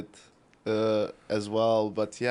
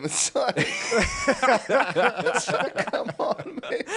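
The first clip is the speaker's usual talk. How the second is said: laughing, higher and breaking; 8 semitones higher, much slower than usual. Real, not acted. Co-host laughing at the Dinko mix-up, words through laughter. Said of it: "laughing at the mix-up".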